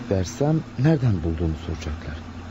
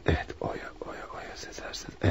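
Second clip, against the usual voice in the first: whispering the name to himself; 12 dB quieter than usual. Acted. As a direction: breathe the name under your breath. Reading name from phone book. (kendi kendine fısıldayarak)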